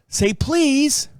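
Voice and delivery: a mild mock of his scolding wife; imitating wife. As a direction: tease her insistence on please. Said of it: "She would go".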